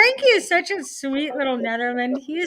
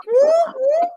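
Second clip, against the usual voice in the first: cheering whoop; 14 semitones higher, 6 dB louder than usual. Real, not acted. Cheering with drinks.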